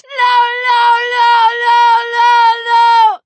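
high, wailing repeated screams; hysterical, loud, crying shriek. Acted. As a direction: shriek over and over in despair. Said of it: "he shrieked".

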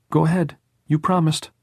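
put-on high boyish prompting; insistent boy. A narrator's reading. Jordan holding Tom to his poetry promise, insistent.

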